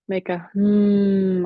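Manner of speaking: drawn-out bee-like hum. Shown as a demonstration. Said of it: "a humming or a buzzing sound like a bee".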